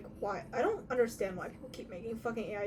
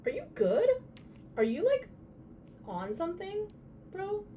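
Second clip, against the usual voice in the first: exasperated; 10 semitones higher than usual. Real, not acted. Exasperated at phone.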